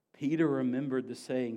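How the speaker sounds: ordinary delivery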